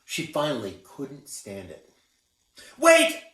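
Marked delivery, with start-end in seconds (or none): 2.8-3.2 high loud shout to stop her; desperate cry. A narrator's reading. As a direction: call out before she leaves. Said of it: "she cried".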